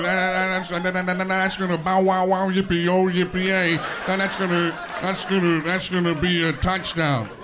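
loud, slow, stammering sportscaster; hammed-up halting football commentator impression; John Madden. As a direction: bumble through it, stuck repeating words. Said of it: "Madden will say things like".

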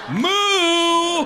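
loud high cow moo; high shouted moo. Acted. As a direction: bellow a swooning moo. Animal swoon noise.

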